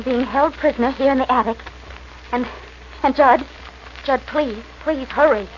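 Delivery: urgent plea. Acted. Begging for help, urgent.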